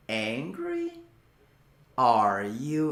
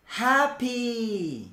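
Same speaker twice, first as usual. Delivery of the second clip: higher drill word; 6 semitones higher than usual. Shown as a demonstration. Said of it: "快乐".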